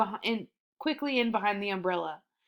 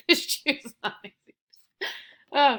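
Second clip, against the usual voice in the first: laughing; 5 semitones higher, slower than usual. Real, not acted. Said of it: "That's hilarious to me".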